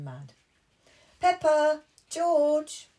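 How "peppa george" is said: loud, high call across the garden; loud calling dad. Acted. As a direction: call the kids in from outside. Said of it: "called Daddy Pig".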